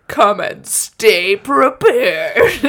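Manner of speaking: slow, loud, drawn out "Come and stay prepared"; mocking slow emphasis. Shown as a demonstration. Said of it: "Would you go if a letter".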